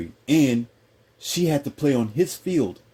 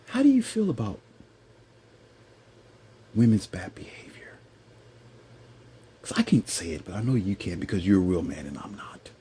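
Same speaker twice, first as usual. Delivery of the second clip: lightly voiced timid questioner; a hint of hushed, nervous confession; mock feminized man; 3 semitones lower, 3 dB quieter than usual. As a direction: tiptoe meekly into a touchy question. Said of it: "you know how they talk?".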